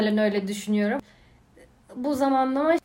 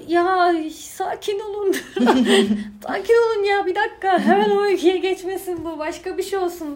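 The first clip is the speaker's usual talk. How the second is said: exasperated inner voice; 6 semitones higher, 4 dB louder than usual. Retold or imitated. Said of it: "falan gibi böyle o karmaşaları".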